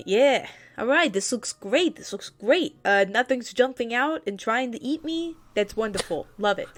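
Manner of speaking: Wartor voice. Acted. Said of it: "And is like".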